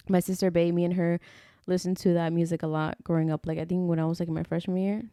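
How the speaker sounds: ordinary talk, nothing marked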